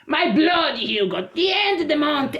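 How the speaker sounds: lightly voiced worried girlfriend; mimicking his girlfriend.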